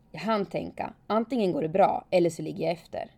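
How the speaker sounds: ordinary talk, nothing marked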